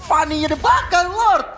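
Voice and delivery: loud, high hype play-by-play, peaking in a shout; esports caster. Acted. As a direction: build to a shout on the big play. Caster hype during Lord fight.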